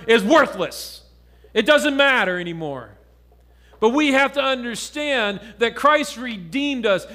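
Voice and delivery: firm pulpit preaching. Acted. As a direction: drive the point home to the congregation. Preaching to congregation.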